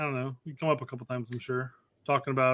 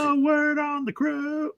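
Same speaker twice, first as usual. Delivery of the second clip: slow, higher barked drill sergeant; drill instructor voice; 11 semitones higher, slower than usual. Retold or imitated. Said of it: "drill instructor".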